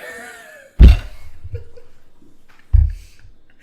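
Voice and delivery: loud, breathless laughing near tears; helpless laugh fit. Real, not acted. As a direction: lose it completely laughing. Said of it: "how is that funny?".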